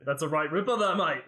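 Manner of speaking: hearty mock-Australian exclamation; best Australian accent. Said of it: "giving you my best Australian accent".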